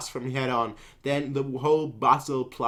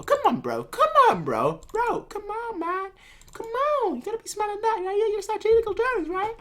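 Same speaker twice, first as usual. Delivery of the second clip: much higher, singsong taunting; high mocking taunt. Kanki taunting the enemy strategists, high mocking voice. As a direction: tease them like a cocky kid. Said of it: "Kanki's like".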